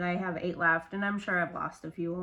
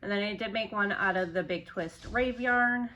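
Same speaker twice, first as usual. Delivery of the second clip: usual speaking voice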